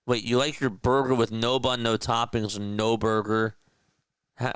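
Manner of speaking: ordinary talk, nothing marked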